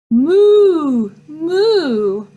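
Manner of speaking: loud high mooing cow. Retold or imitated. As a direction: moo out like a cow in the field. Let's see what a real cow sounds like.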